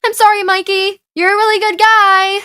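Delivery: high, loud sing-out apology; called-out apology. Acted. Girl to the boy she locked in, calling out sorry. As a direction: call it out loudly to someone shut away.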